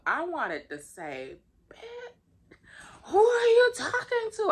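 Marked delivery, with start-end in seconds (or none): 1.7-4.5 shrieking, much higher and louder furious comeback; going off. Retold or imitated. Tenant telling off the rude property manager, furious high yell. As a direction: blow up at her, voice way up high. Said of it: "I wanted to go off on her like that".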